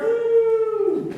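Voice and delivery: high, loud little cheer of joy; a bright woo when the code runs; excited whoop. Real, not acted. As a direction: celebrate the win with a quick whoop.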